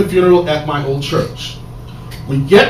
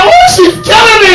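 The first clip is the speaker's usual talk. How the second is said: laughing exclamation; 13 semitones higher, 5 dB louder than usual. Retold or imitated. Reacting to singer.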